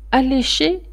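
usual speaking voice

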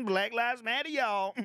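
high, loud sassy counter-lady voice; Popeyes lady voice. Acted. Mocking a restaurant lady, in a high loud sassy voice. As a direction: ham up a soul food counter lady calling customers in. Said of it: "She talks like the, the Popeyes lady".

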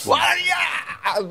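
very high, slow wailing shout; drawn-out wail. Retold or imitated. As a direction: wail it out as the sobbing woman. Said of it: "女の人が". (泣き叫ぶ女性)

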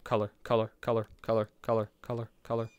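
low, soft, even chant of one word; muttered repetition. Real, not acted. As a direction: murmur it over and over to yourself. Chanting a word while searching.

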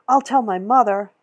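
yelling to be let go; girl yells at the store lady holding her; shouting girl. A narrator's reading.

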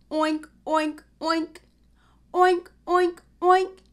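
loud high oinking; pig oink. Shown as a demonstration. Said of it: "A pig says".

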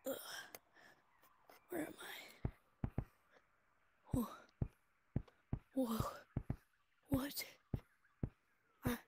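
slow, confused whisper; dazed whisper. Real, not acted. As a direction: whisper it slowly, disoriented. Landed somewhere after falling.

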